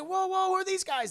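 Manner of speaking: high, alarmed youngster's voice; acted high-pitched confusion; startled Peter. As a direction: blurt it out in bewildered surprise. Peter startled by agents arriving.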